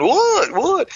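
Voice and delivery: very high frantic repeated question; panicked what. Retold or imitated. Patient in the booth frantically asking what's wrong. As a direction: squeal it twice, scared something's wrong. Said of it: "I'm freaking out when he does that".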